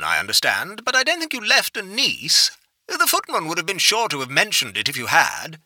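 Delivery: lightly voiced bemused aristocrat; an old lord, amused and puzzled; genteel old lord. A narrator's reading. Lord Carrywood's voice.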